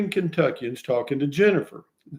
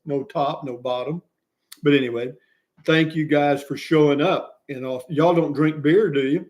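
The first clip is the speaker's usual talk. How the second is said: usual speaking voice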